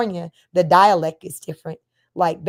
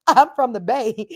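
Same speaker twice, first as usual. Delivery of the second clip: normal talking voice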